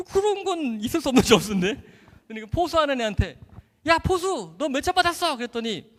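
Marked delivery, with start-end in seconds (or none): 3.9-5.4 high-pitched shout to a teammate; brisk re-enacted call. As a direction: holler the question across the field. Calls out to the catcher.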